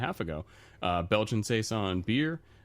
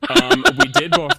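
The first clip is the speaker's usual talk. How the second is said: laughing; 14 dB louder than usual. Real, not acted. Admits bringing Sparks, laughing.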